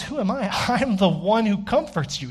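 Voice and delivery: high, emphatic "Who am I?" then laughing answer; emphatic God's voice. Pretend. God answering Israel's doubt, high and emphatic. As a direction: declare it with emphasis. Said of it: "It's emphatic".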